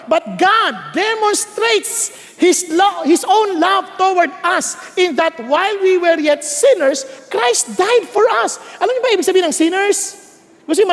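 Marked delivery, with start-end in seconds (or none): 0.1-8.6 scripture reading. Acted.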